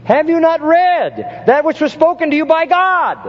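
mock the Sadducees' ignorance with a sneer; sarcastic. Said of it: "that's just so sarcastic".

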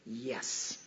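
low, hushed little answer; sober. A narrator's reading. Four-year-old answering her mother, soberly. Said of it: "April said soberly".

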